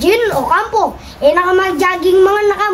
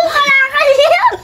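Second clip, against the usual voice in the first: excited shout; 8 semitones higher, 4 dB louder than usual. Real, not acted.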